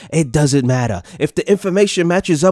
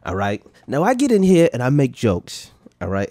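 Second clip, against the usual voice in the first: normal talking voice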